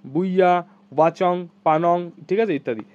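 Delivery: ordinary delivery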